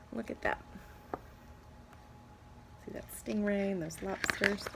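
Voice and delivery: ordinary delivery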